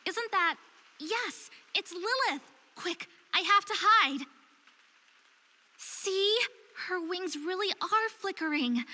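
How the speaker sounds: spotting her and scrambling to hide; startled excitement. Acted. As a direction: she spots Lilith and ducks out of sight, high and excited. Spots Lilith, must hide.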